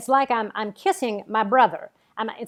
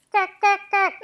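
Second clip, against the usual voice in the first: high squawky cack cack cack; high cackling noise; 9 semitones higher than usual. Acted. Lorraine cackles nervously at Marty, high squawky cackle. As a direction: cackle like a startled hen.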